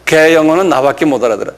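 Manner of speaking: normal talking voice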